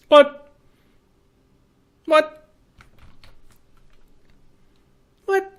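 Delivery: high, short cries of disbelief; high-pitched bafflement; baffled. Real, not acted. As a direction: squeak it out, dumbfounded. Baffled by the sudden game outcome.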